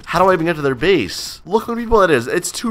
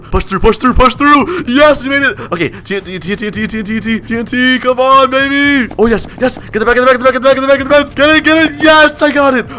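higher, louder excited gamer shouting; hyped clutch yelling; 10 semitones higher, 4 dB louder than usual. Real, not acted. Gamer urging himself through a risky raid, excited shouting. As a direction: push hard, yell it through the clutch. Tense clutch play in game.

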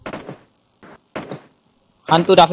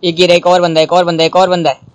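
excited callouts; 3 semitones higher, 6 dB louder than usual. Real, not acted.

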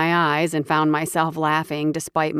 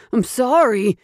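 higher, louder panicked "sorry"; yelping apology; 6 semitones higher, 5 dB louder than usual. Acted. Thief yelps sorry at the hero, shrieking. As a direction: blurt "sorry" up high and loud. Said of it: "Shrieking and yelping".

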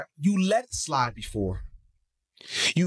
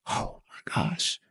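hushed, drawn-out awe; awed humility; 6 semitones lower, 5 dB quieter, much slower than usual. Acted. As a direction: breathe it out softly, overwhelmed by mercy. Voices awe at undeserved mercy.

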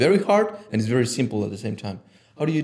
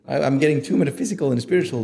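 ordinary talk

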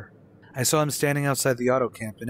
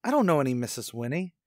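high, rushed, disbelieving questions; grasping that the number is right but the voice is wrong; bewildered disbelief; 7 semitones higher than usual. Acted. Caller to the stranger, high bewildered disbelief.

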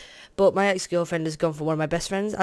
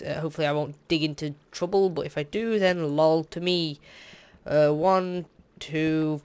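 usual speaking voice

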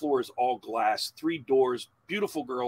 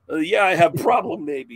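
loud, higher comeback parroting the stranger; mocking echo; 6 semitones higher, 10 dB louder than usual. Dad snapping back at the Russian stranger, loud mocking echo. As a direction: throw his broken English back at him louder. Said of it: "He's like, "You have problem, maybe?".

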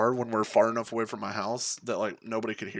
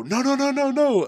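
high, loud panicked yelling of no; repeated frantic no, shouted high; 7 dB louder than usual. Retold or imitated. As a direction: friends shouting for him to stop, alarmed. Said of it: "I hear them go".

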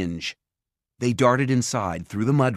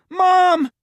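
much higher, much louder shout; calling upstairs; 10 dB louder than usual. A narrator's reading. Simon shouts up the stairs for his mother, high and loud. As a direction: yell it up the stairwell. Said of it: "he called".

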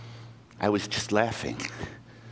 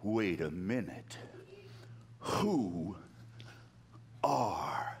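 low soft slowed question; suspicious hush; 4 semitones lower, 11 dB quieter, slower than usual. Acted. Lion quietly eyes the mouse, low and soft. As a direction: lean in warily.

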